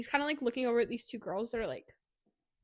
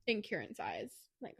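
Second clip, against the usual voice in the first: usual speaking voice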